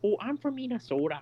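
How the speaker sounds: put-on a cheesy Minnesota accent. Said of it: "stereotypically Canadian".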